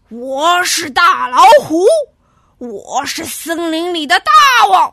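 loud, proud tiger bellow; boastful shouting. A narrator's reading. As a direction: roar out that you rule the forest. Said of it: "大呼大叫".

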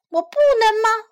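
high, loud puzzled question; questioning fox. A narrator's reading. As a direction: ask it as a thwarted fox.

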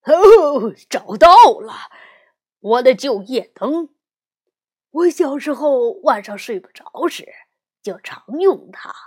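loud, delighted papa bear; happy. Acted. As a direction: cry out with glee at finding it. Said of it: "熊爸爸高兴地说".

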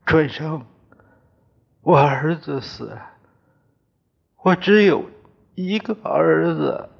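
crying. A narrator's reading. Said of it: "又哭了".

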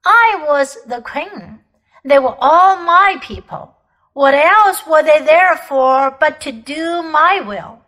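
proud imperious queen. Acted. Queen boasting to the children of her war, imperious. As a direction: declaim it like a cold, proud empress.

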